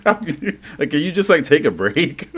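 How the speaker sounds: high, talking through real laughter; laughing disbelief. Real, not acted. Mocking an irritated character's rush off alone, laughing incredulously.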